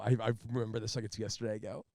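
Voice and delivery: ordinary delivery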